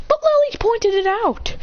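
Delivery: high, drawn-out complaint; protesting. Acted. Arguing back that Lily pointed it out, high protest. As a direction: push back with a stretched whine.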